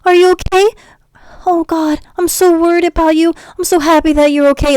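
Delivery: high, gushing mock concern; hammed-up worried sweetheart voice; mock fussing lover. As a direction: coo over them like a worried lover. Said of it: "They're always like".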